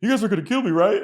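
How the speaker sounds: high, loud joking fear; half-laughing worried question; nervous kid in the back seat, mock fearful. Jay scared joke in story.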